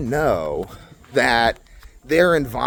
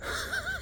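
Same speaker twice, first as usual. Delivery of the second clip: high breathy laugh; 14 dB quieter than usual. Real, not acted. Laughing at negotiating with toddlers.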